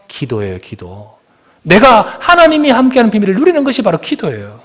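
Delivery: 높고 힘차게 외치는 설교; fervent preaching. Acted. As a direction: preach it loud, rising, urging the flock. Preaching at full force. (열정적으로 외치는 설교)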